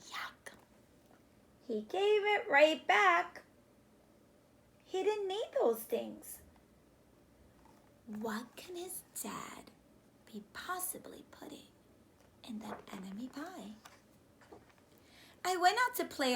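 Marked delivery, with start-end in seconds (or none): 0.1-0.5 soft disgusted whisper; whispered yuck. Acted. 8.2-13.7 hushed wondering whisper; soft stage whisper to the class. Acted.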